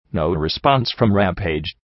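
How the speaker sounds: usual speaking voice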